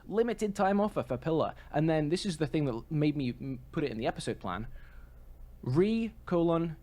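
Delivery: usual speaking voice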